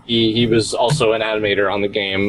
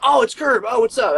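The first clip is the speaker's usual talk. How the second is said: excited fan greeting; 12 semitones higher than usual. Retold or imitated. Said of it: "they're like".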